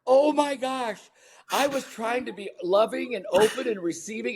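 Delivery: high-pitched, aghast exclamation; mock horrified realization. Dad realizing he frightened his adopted son, mock horrified realization. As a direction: act out dismay with pitch way up.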